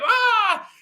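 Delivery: high, loud yell; short full-voiced cry of dismay; retold scream. Upset man telling how he lost it, high scream.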